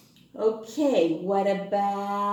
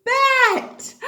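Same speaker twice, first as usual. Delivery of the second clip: excited; 8 dB louder than usual. Shown as a demonstration. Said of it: "Are you ready?".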